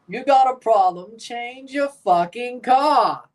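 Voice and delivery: forceful mock British accent, slowing to the end. Shown as a demonstration. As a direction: show off an irate British boss's accent.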